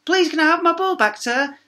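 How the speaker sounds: put-on high, childlike pleading voice; timid pupil. Pupil asks teacher for ball, high child's plea. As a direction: plead politely as a small child.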